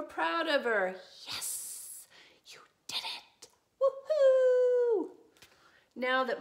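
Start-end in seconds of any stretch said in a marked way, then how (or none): 1.3-3.5 excited whisper; whispered cheer. Acted. Family proud of sister, whispering excitedly. 3.8-5.2 high, loud whoop; woohoo cheer. Acted. Family celebrating sister, loud whoop.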